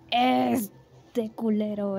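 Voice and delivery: very slow, stretched first word; drawn-out choosing. Real, not acted. Player choosing an avatar, slow drawn-out pick. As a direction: draw it out while picking one.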